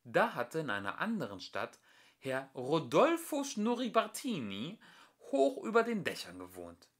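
ordinary delivery

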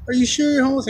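high-pitched incredulous question; imitating doubtful woman. Woman questions the man's homelessness, imitated disbelief.

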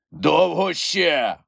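slow, high roaring creature voice; Grampus roaring into the wind. A narrator's reading. Grampus against the howling wind, slow roar. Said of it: "врешті заревів Грампус проти лютого вітру".

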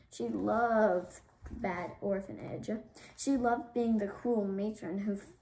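usual speaking voice

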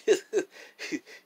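high, scoffing burst of laughter; mocking laugh. Real, not acted. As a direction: laugh it off in contempt. Laughs at describing a hairdo.

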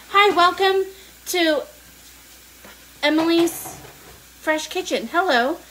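high, loud cooking-show greeting; show-host welcome. Pretend. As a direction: open the show with big, punched words. Opens her cooking show.

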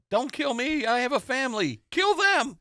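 Homer Simpson voice. Retold or imitated. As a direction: beg for your life in a panic. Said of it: "Homer says".

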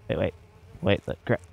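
ordinary talk, nothing marked

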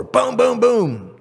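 loud, high booming knocks; punchy storyteller sound effect; imitated knocking. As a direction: pound out the knocks with your voice.

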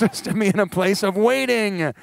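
ordinary delivery

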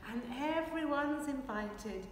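ordinary delivery

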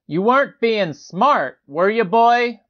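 ordinary delivery, nothing marked

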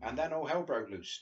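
normal talking voice

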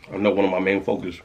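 normal talking voice

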